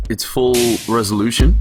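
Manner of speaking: normal talking voice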